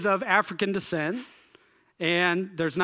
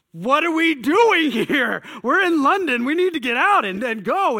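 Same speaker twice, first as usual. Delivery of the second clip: fuming rant; 7 semitones higher, 5 dB louder than usual. Retold or imitated. Stewing teen venting at his shopping buddies, loud angry outburst. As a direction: let the frustration boil over. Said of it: "I'm like".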